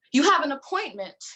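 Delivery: "You have an appointment" spat out, have hit hard and falling; spat back. Acted. Therapist spits the words back at the patient, curt. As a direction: bite off "have" like an accusation. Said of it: "she spits back".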